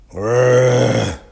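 man growling at his broken mower; cross growl. A narrator's reading. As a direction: growl it like an annoyed grown-up. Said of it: "the man said crossly".

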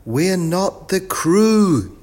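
high, slow, drawn-out yell; yelled protest. A narrator's reading. Biff corrects the professor, yelling high and slow. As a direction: yell the protest, stretching the last word. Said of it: "yelled Biff".

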